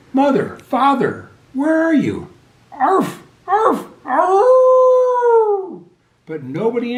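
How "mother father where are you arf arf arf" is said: high, loud puppy calls for mother and father; crying out. A narrator's reading. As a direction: call out desperately for her lost family. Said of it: "Wags cried out".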